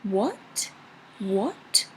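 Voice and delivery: "What" swept upward like a question; questioning rise. Shown as a demonstration. Teacher likening second tone to a question, rising "What". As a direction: ask "What" with a clear rise to the end. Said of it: "is like a question".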